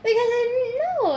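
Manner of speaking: laughing. Real, not acted. Laughing at own ignorance.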